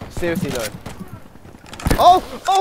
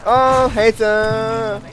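slow, stretched-out call; drawn out; 7 semitones higher, 5 dB louder, much slower than usual. Real, not acted. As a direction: stretch the words out long. Drawn-out announcement.

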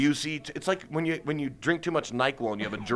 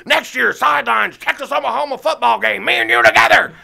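much higher, loud, manic pitch; Gary Busey impression; 12 semitones higher, 11 dB louder than usual. Busey pitching a football date to Toby, high and loud. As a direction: pitch it like a man with wild plans. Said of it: "And he's like".

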